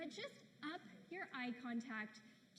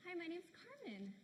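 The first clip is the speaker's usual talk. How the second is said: CARMEN lifted high, like asking; soft self-introduction with questioning rise on Carmen; sounds like a question; 5 semitones higher, 5 dB quieter than usual. Shown as a demonstration. Woman introducing herself unsurely, as a question. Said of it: "introduce yourself and it sounds like a question".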